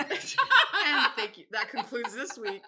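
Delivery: drawn-out thanks, laughing; mock sign-off. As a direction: pretend show outro through giggles. Pretend sign-off ending the podcast.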